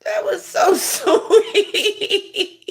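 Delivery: high, slow, breaking into real laughter; giggling at a sweet comment, drawn out high; laughing. Real, not acted. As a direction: let the laugh take over the words. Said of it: "I'm laughing because you're so sweet".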